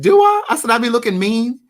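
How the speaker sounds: high, loud startled Do I; mock surprise. Answering the fan who said he looks mean, surprised. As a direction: act taken aback at being called mean. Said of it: "and I said".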